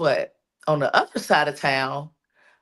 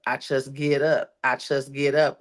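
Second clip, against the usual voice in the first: normal talking voice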